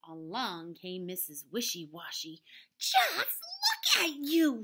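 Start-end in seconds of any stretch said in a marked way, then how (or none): 2.8-4.6 high, loud, slow scolding; shocked mother scolding; screamed. A narrator's reading. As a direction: scold the muddy animals in horror. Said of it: "she screamed".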